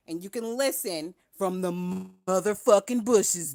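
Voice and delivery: usual speaking voice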